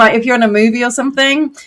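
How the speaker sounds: usual speaking voice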